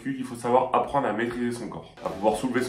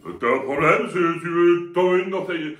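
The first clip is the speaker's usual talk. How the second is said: loud, higher-pitched thuggish threat, played for laughs; mock threat; 8 semitones higher, 4 dB louder than usual. Joking reply to the granny, mock-aggressive threat. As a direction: act the brute picking a fight. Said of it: "Et moi, je fais".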